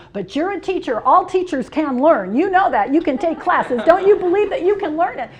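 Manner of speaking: mom shaming. Retold or imitated. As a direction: push her like a mother shaming her grown daughter. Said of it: "shamed me into".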